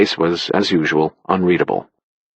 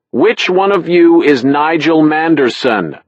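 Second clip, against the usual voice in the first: higher call across the pub, slowed; shouting out; 8 semitones higher, 4 dB louder, slower than usual. A narrator's reading. Narrator calls across the pub to find Nigel, a raised shout. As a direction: call out to the whole room. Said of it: "I turned and shouted out".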